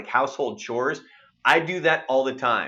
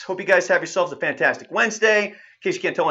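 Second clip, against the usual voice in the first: ordinary talk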